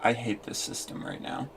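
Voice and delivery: real whispered frustration; defeated whisper. Real, not acted. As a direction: breathe it out, worn down. Frustrated after crash.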